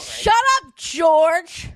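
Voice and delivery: high, loud shut up; playful yell. Real, not acted. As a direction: yell it at your friend. Yells at friend for teasing.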